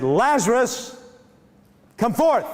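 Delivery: loud, slow, high command; calling out. Retold or imitated. Jesus calling Lazarus out of the tomb, commanding loud. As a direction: call him out of the tomb with full voice. Jesus calls dead man out.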